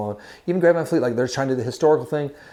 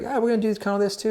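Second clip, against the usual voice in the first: lightly voiced Beatle; 11 semitones higher, faster than usual. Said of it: "the [name] like".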